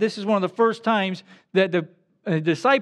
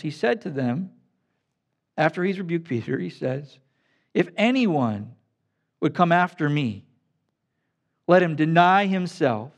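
scripture reading; 5 semitones lower than usual. Acted. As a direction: read the passage gravely, letting the words settle. Reads scripture slowly and low.

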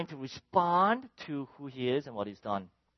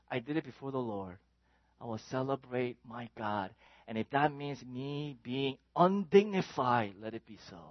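hushed, measured reply; calm resolve; 3 semitones lower, 7 dB quieter than usual. Acted. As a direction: answer softly, standing firm. David calmly answers his wife.

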